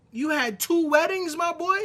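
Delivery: loud, high-pitched disbelief; incredulous outburst. Real, not acted. Host confronting a man with two families, incredulous outburst. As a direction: pitch climbing into a shouted question.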